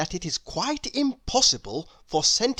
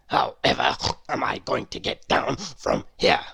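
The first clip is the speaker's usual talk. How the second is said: much lower, whispery pig voice; wailing pig; 11 semitones lower than usual. A narrator's reading. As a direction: wail it like a stuck piglet. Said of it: "wailed the pig".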